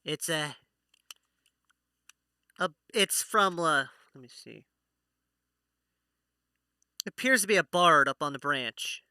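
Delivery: ordinary delivery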